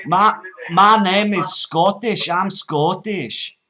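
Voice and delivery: put-on loud, slow, high Scottish accent; put-on Scots, drawn out and loud; broad Scottish accent. Prank caller to scammer, broad Scottish.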